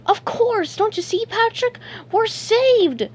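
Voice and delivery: very high, gleeful cartoon voice; high-pitched elated outburst; excited relief. A narrator's reading. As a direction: burst out with breakthrough excitement. SpongeBob realizes they are saved.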